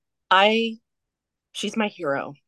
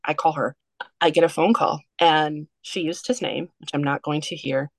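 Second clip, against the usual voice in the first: ordinary delivery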